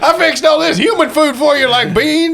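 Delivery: put-on high, loud old prospector voice; cheerful old-timer serving dinner. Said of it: "It's like".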